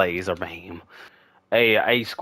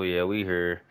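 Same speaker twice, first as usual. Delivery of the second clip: normal talking voice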